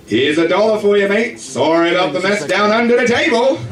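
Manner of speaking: cheery Australian tourist; Australian accent. Retold or imitated.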